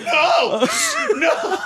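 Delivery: high yelled No; mock scream. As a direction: shout it like a screaming clip. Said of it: "somebody smashing a bottle and screaming".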